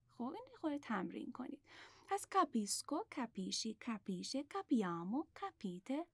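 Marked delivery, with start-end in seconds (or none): none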